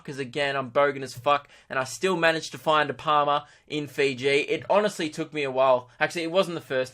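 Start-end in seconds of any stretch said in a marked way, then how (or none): none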